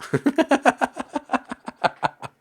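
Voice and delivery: loud, high burst of laughter; long rapid giggle, trailing off; laughing. Real, not acted. Laughing at own joke.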